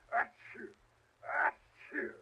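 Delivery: two hammy cold sneezes. Acted. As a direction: sneeze twice, then recover. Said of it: "there I go again".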